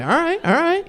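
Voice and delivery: high, jittery mock calming; nervous placating. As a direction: soothe the dogs nervously. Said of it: "You're like".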